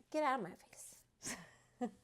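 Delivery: slow hushed whisper; breathy slow whisper; whispered shoo. Real, not acted. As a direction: whisper it at the dog, teasing. Whispering at dog.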